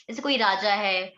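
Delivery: ordinary delivery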